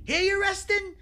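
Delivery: high, loud, incredulous wiseguy; Joe Pesci. Retold or imitated. Storyteller plays Pesci recounting a cop's question, high mock disbelief. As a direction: impersonate a wiseguy squawking in disbelief. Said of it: "you got his, uh, impression down".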